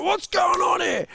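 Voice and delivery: high, loud demanding parent; stern parent. Retold or imitated. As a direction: parents arriving at chaos, demanding.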